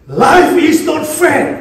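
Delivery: put-on high, slow whiny child voice; imitating a little kid's protest; child's complaint. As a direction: whine it like a small child. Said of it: "Kemudian dia berkata".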